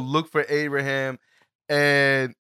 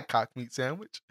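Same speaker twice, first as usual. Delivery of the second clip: ordinary talk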